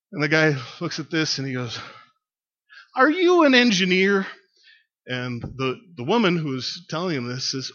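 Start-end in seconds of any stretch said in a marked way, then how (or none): 2.9-4.2 high, loud acted holler from above; shouted question. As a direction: call the question down loudly.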